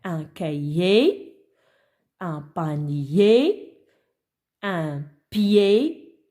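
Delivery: drawn-out words, rising endings; slow demonstration. Shown as a demonstration. Teacher has pupils listen for the repeated sound, slow demonstration. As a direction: stretch each word so its final sound stands out. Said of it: "Écoutez et dites-moi quel est le son".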